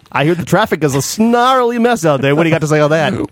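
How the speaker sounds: hammed-up radio banter. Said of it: "radio voice".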